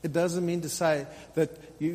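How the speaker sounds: ordinary talk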